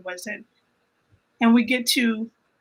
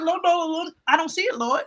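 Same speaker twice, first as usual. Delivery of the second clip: pleading with God; 6 semitones higher than usual. Retold or imitated. Retold plea to God.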